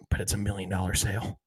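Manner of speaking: hushed aside with a hand over the phone; Michael. Retold or imitated. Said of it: "Michael covers the, covers the phone".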